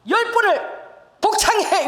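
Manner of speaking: loud, high, slow drill command; 천천히 크게 명령하듯; shouted order. Retold or imitated. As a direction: order her to repeat it, slowly and loud. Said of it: "이러는 거예요".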